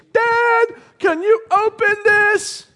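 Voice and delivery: put-on high, loud kid yelling for Dad; shrill-pitched child's demand, drawn out; excited kid. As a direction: holler like a child with a new present. Child on opening a present.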